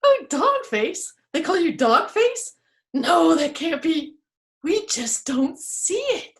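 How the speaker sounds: kind tree voice. Acted. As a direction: reassure the teased tree. Trees reassure Dog Face.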